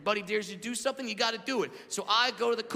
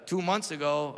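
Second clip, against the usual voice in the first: ordinary delivery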